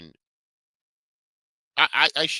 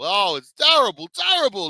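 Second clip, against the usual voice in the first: mock outrage; 14 semitones higher, 6 dB louder than usual. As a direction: ham up the disgust on terrible. Mocking exaggeration of the coverage.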